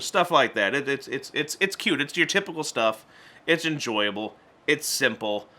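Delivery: ordinary talk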